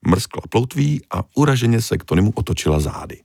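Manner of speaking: ordinary delivery, nothing marked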